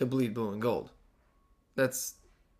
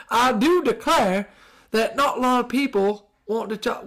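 put-on Southern accent; 9 semitones higher, 4 dB louder than usual. Podcast host mocking coach Brian Kelly, hammed-up Southern accent. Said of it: "what accent should I do".

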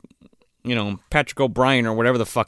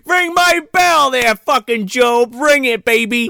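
shouted movie quote; 10 semitones higher, 4 dB louder than usual. Acted. Friend breaks into a quote at the others, hammed-up shout.

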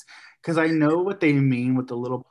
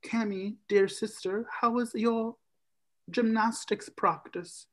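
a higher, lightly put-on sugary big brother; lightly hammed-up doting brother, higher; sweet brother voice; 8 semitones higher, 4 dB quieter than usual. Brother sweetly asks his sister about gymnastics, mock-doting. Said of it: "Brogan's like".

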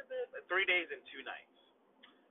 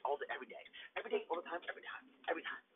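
hushed rattling off; 7 dB quieter, much faster than usual. Retold or imitated. Boasting he walks daily.

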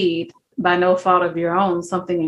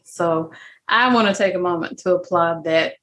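ordinary talk, nothing marked

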